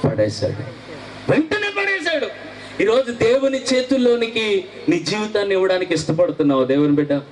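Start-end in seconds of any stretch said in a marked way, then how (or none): 1.3-7.2 high, pleading preacher's call, pitched well up; fervent altar call. Acted. Preacher calling youth to give their lives, high pleading cry. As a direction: urge the young people to surrender.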